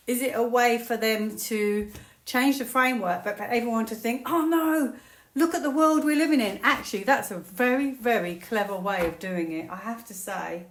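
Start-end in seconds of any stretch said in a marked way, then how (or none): 4.3-6.6 higher, louder worried exclamation; mock dismay. Voicing what everyone is meant to think, mildly hammed-up dismay. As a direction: lightly act out a dismayed public.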